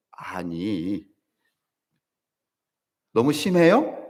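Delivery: ordinary delivery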